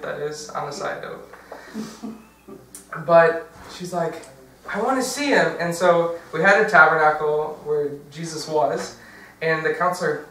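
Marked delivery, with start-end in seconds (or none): 4.7-5.6 put-on high-pitched little girl insisting; raised pitch, digging in; defiant. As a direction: mimic a child's stubborn demand. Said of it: "and she's defiant".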